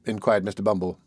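plain reading voice

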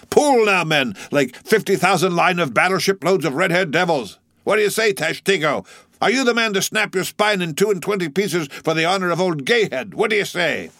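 high-pitched, breakneck exhortation; rousing cry. A narrator's reading. Stubb urges Tashtego and crew to pull, rousing cry. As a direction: drive the rowers on, voice pitched high. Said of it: "cried Stubb".

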